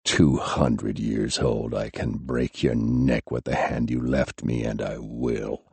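low, soft, thick-voiced threat. A narrator's reading. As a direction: push the words out through pain. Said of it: "he said thickly".

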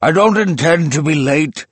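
snapped. A narrator's reading. Old man rebuffing the professor, loud sharp snap. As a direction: bite back at the doubter. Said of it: "snapped the old man".

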